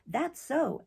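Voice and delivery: lifted, hearty burst of agreement; hearty exclamation. A narrator's reading. Ben heartily agreeing with his father's vow, hearty exclamation. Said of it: "as he exclaimed heartily".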